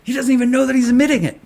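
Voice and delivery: triumphant, can't contain the thrill of catching them; real excitement. Real, not acted. Streamer catching regulators admitting it, in real excited glee. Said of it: "I'm so fucking excited".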